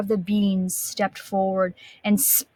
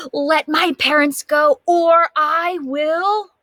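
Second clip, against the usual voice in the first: higher, louder, slowing on the end; loud threat; 6 semitones higher, 5 dB louder, slower than usual. Acted. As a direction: threaten them, dragging out the last words. Martha threatens the beans.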